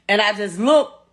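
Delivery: ordinary talk, nothing marked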